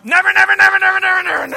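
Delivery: mock yell, very high and loud, at the boss. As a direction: shout never at your boss for fun. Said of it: "pretend like you're talking to your boss".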